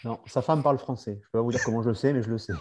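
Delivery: talking mid crunch; strained voice while doing crunches; doing sit-ups. Real, not acted. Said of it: "je fais des abdos".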